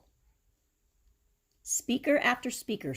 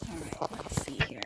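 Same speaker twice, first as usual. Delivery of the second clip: soft whisper to herself; whispered aside; 3 semitones lower, 6 dB quieter than usual. Real, not acted. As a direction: whisper it under your breath.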